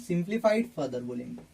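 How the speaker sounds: usual speaking voice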